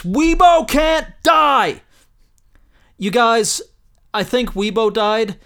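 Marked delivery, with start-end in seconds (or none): 0.0-1.8 slow, much higher, louder anguished yell; all caps shout. Acted. Reading the all-caps tweet, a slow hammed-up yell. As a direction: drag out each word in mock agony. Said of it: "all caps".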